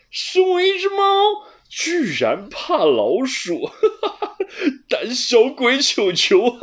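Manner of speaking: high, loud teasing cat with laughs; defiant taunting. A narrator's reading. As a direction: hit back and call him a coward. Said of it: "不服气地说". (不服气的布鲁斯，高声笑着挖苦)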